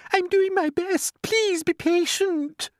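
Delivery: very high, slow little voice; poor glowworm. A narrator's reading. Glowworm begging the others to wait, high slow plea. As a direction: plead slowly, high and strained. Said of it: "answered the poor glowworm".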